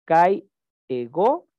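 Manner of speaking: kai, ego said very slowly with a clear break between the two words; slow demo. Shown as a demonstration. Teacher showing the class the two words before they merge, slow. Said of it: "demonstrating the crasis example".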